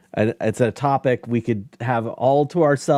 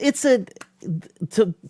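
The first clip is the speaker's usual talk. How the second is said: ordinary delivery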